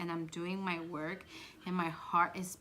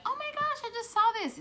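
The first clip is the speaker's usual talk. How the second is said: very high, loud mock squeal; excited kid squeal; 9 dB louder, much faster than usual. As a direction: squeal the news like an excited child. Imitating her excited child self.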